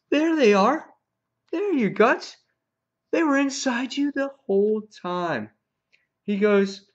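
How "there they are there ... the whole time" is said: high, loud mock discovery; hammed-up gloating, word by word; taunting. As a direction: crow over a victim in pretend wonder. Acting Negan taunting Spencer about guts.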